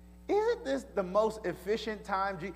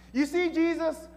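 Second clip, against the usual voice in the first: pleading outburst; 3 semitones higher, 3 dB louder than usual. Retold or imitated. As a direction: cry out the shame passionately. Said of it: "That's what she said".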